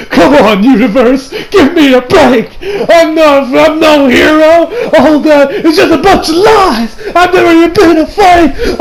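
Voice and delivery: high, loud, drawn-out pleading; desperate mock outburst. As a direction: wail it like a panicked fraud confessing. Acting out hero's desperate outburst.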